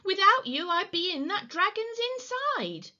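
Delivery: high, gushing relief; high-pitched, thankful exclamation; grateful witch. A narrator's reading. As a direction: exclaim it high to your rescuers. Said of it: "the grateful witch cried".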